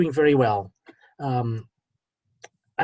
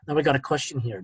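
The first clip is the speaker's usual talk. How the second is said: ordinary talk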